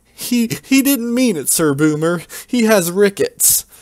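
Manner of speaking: high nervous apology; high pleading voice. Acted. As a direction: beg the angry knight timidly. Hero pleads for his friend.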